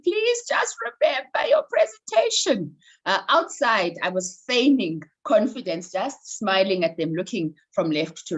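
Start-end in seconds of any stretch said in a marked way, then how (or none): 0.0-2.8 much higher, slow, pleading inner voice; panicked inner plea. Retold or imitated. Frozen on stage, pleading silently with herself, higher and slow. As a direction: beg your own mind to remember, slowly. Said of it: "I was going".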